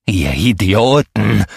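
slow, loud furious bellow. A narrator's reading. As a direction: roar it out in a rage. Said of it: "brüllte der wild gestikulierende".

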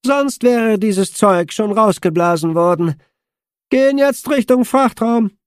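high, loud, distorted radio voice; Olli distorted voice. A narrator's reading. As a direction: speak through a crackling, distorted comm channel. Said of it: "Ollis verzerrte Stimme".

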